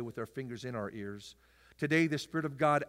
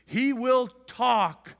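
loud, high preacher's proclaiming cadence; full-force preaching; 7 semitones higher, 7 dB louder than usual. Acted. Pastor proclaiming to the church, loud and high. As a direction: proclaim each promise to the congregation.

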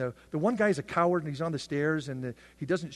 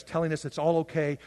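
normal talking voice

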